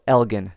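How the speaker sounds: Elgin with a hard G, as in "get"; hard G pronunciation. Shown as a demonstration. Podcaster repeating a listener's correction, hard G in Elgin. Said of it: "he says it's a hard G".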